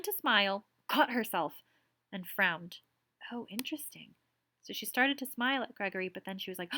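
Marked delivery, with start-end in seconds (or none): 3.3-4.1 soft whispered aside. Real, not acted. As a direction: breathe it to yourself. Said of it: "whispered aside".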